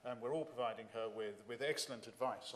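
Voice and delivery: ordinary delivery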